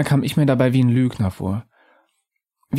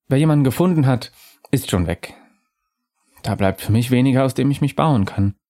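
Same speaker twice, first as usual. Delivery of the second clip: higher, airy spirit voice, calm and knowing; a lightly raised ghost voice musing to the narrator; ghostly Undine; 4 semitones higher than usual. Acted.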